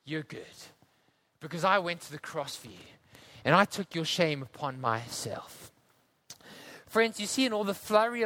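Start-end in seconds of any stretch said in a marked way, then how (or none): 0.0-5.7 low, soft reassurance; Jesus reassuring. Retold or imitated. Jesus gently reassuring the sinner, softer. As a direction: speak it gently like forgiveness. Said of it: "Jesus says".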